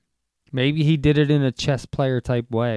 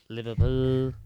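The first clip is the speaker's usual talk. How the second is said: Liverpool accent; slower than usual. Shown as a demonstration. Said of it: "'Cause that's how they say it".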